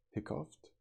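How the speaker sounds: "Hiccuped?" rising high in disbelief; puzzled query. Real, not acted. Reader puzzled at a strange word, rising query. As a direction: question the odd word. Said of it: "Wait, that's not a hiccup".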